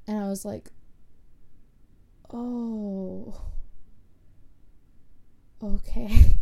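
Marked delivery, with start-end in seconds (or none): 2.3-6.4 stunned, mock surprised understanding; playful retold oh okay; sudden realization. Mom explains panic attack.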